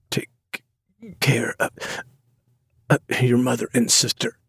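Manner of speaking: faint halting father's voice; dying whisper. Acted. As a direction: struggle to get the words out. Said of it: "he whispers".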